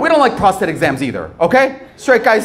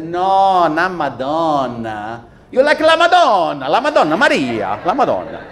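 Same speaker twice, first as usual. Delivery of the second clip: Italian aunt; slower than usual. Retold or imitated. Comedian imitating his Italian aunt teasing him, heavy Italian accent. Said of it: "she said".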